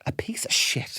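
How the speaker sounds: whispered punchline; mock mum hiss. Mum scolding the kids, whispered punchline. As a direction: hush the last words.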